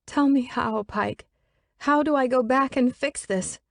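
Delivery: high pleading female voice; distraught young woman. Acted. Elizabeth pleads with the absent Pike, high and distraught. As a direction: beg an unseen friend for help.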